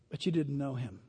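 ordinary talk

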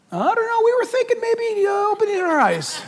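high, put-on comic voice; hesitant answer in a high mock voice; blind men answering. Blind men answering Jesus's obvious question, comic high voice.

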